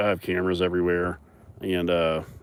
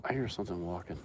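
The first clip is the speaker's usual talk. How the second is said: 조용히 속삭이듯 (much softer, almost a whisper); 소리 죽여 말하기 (speaking with the voice held down); hushed aside; 3 semitones lower, 15 dB quieter, faster than usual. Real, not acted. Hears noise, drops voice.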